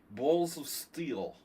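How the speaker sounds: slow, drawn-out Russian accent, marbles in the mouth. Shown as a demonstration. Streamer showing chat how to tell the Balls of Steel story, marble-mouthed Russian accent. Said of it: "talk like you have marbles in your mouth".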